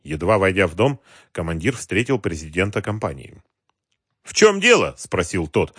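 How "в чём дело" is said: high, loud demand; indignant question. A narrator's reading. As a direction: demand an explanation from intruders. President confronts intruding commander. (возмущённо, высоко и громко)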